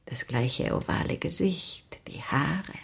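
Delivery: slow, whispered and tender; soft whisper. Acted. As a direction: murmur it as if remembering. Lizzie tenderly compares Emily to friend.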